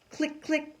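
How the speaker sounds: high, loud clicks; a girl snapping pictures of the bird; camera clicks. A narrator's reading. Camera sound, snapping fast.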